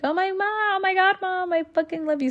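high excited squeal, a big jump up in pitch; squealing delight. Retold or imitated. Daughter gushing to mom over a bag of makeup, high delighted squeal. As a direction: gush over the bag of makeup like a thrilled kid. Delighted reaction to mom's gift.

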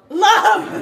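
sudden loud shouted word; yelled love. Retold or imitated. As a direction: belt it out at full volume.

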